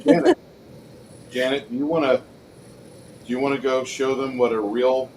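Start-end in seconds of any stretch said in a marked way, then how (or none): 0.0-0.4 calling out. Real, not acted. Calling player loudly.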